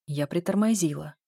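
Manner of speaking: ordinary delivery, nothing marked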